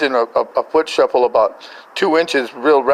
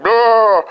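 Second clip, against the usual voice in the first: loud, high drawn-out "blah"; comic roaring sound effect; imitated plague noise; 8 semitones higher, 7 dB louder than usual. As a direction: spew out a mock swarm noise. Imitates wasp plague pouring off.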